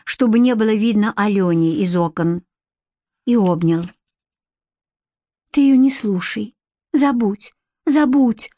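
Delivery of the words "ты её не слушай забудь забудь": rushed pleading. A narrator's reading. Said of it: "зачастила девушка".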